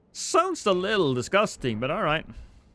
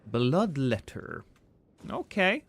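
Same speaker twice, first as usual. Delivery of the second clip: ordinary delivery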